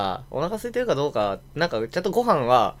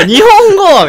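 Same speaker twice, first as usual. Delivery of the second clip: high shout, breaking into laughter; laughing shout; 16 dB louder, much slower than usual. Retold or imitated. I yell at my friend to stop using Japanese, laughing. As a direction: yell the correction through a laugh. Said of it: "みたいな".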